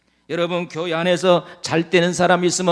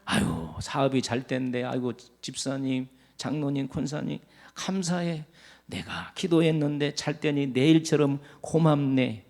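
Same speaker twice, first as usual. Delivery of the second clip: much softer, gushing congratulations; sweet, fond praise for a neighbour; congratulating a church member; 5 semitones lower, 9 dB quieter than usual. Shown as a demonstration. Preacher shows how to bless a successful church member. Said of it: "축하해 주시기를 바랍니다".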